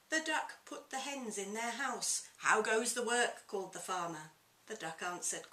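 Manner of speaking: lively picture-book reading aloud; story narration. A narrator's reading. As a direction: read it to children with relish.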